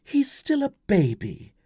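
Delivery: high, slow woman's voice in protest; protesting mother. Acted. Wife to husband about their son, protesting. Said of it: "Flavia protested".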